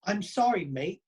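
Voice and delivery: a lightly done Aussie "sorry, mate"; jokey Australian accent, only lightly put on; mock Australian accent. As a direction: tease them with a hammed-up Aussie apology. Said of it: "That was Australian, bro".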